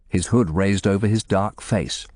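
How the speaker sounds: ordinary delivery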